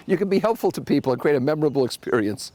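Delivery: ordinary talk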